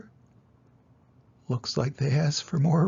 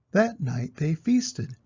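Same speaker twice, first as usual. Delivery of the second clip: plain reading voice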